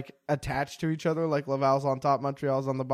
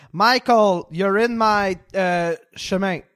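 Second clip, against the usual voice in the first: put-on louder, higher French-accented English; thick French accent; 3 semitones higher, 5 dB louder, slower than usual. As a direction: imitate a francophone schoolgirl's heavy French accent, groping for the word. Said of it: "she did it with the thickest French accent".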